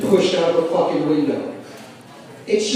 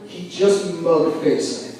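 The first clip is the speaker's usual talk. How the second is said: ordinary delivery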